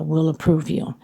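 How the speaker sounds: usual speaking voice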